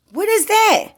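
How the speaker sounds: high amazed "what is that"; retold amazement. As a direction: squeal it out in disbelief.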